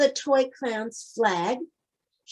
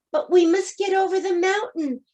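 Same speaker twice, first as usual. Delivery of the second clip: higher, urgent toys; urgent plea; 7 semitones higher, faster than usual. A narrator's reading. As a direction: press her, time is short. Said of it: "said all the dolls and the toys".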